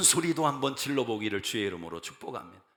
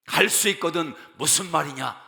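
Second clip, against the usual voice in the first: loud proclamation; 3 semitones higher, 7 dB louder than usual. Acted. Verse proclaimed again.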